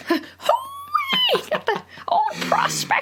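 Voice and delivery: put-on high, cracked old-timer holler; old prospector. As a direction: yell like a grizzled gold prospector. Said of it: "you got the prospector voice".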